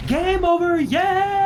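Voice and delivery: high, loud, triumphant announcer shout; triumphant game jingle. Retold or imitated. Recalling a racing game's death jingle, triumphant shout. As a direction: belt it like a victory fanfare. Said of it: "the jingle is".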